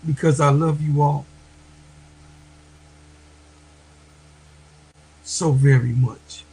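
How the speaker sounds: tearful, heartfelt thanks to the sisters; real crying. Real, not acted. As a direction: push the words out through real tears. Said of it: "Real men cry too".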